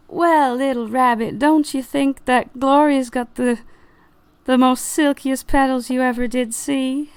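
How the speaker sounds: high-pitched flattering flower; leading question in a high character voice; Violet. Acted. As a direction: press the listener to admire your friend. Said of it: "Violet looks down and goes".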